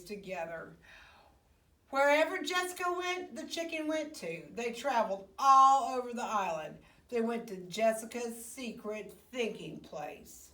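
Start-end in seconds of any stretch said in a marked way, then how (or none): none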